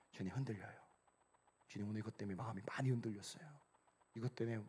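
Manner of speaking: soft, low prayer; hushed confession. Shown as a demonstration. Believer confessing a shaken heart to the Lord, hushed. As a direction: confide it quietly, as if praying alone.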